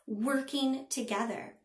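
ordinary delivery, nothing marked

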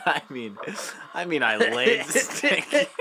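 laughing through the words, slow; rueful laugh. Real, not acted. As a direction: laugh it out sheepishly.

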